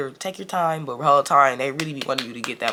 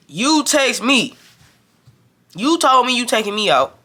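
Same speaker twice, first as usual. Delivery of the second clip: high, louder retold accusation; irritated protest; 9 semitones higher, 5 dB louder, slower than usual. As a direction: throw it back at a friend who broke her promise. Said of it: "Now I'm irritated as hell".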